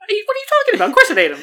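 squeaky high incredulous protest; mock disbelief. Real, not acted. Arguing with Joanna about the hologram, squeaky disbelief. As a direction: act scandalized she'd doubt it.

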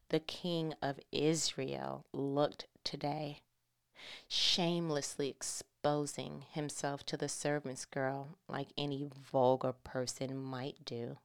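slow, soft, dismissive; disdainful. Acted. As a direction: sneer without looking up. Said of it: "looking at her nails like".

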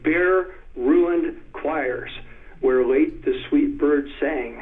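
very slow, Bare ruined choirs each word hit hard; three stressed hits. Shown as a demonstration. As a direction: boom out three heavy beats in a row. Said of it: "booming three hits in a row".

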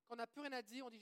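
ordinary talk

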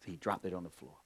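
ordinary delivery

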